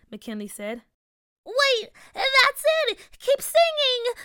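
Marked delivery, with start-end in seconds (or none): 1.5-4.1 high, thrilled fairy cry; excited. A narrator's reading. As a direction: burst out in excitement. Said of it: "the fairy said excitingly".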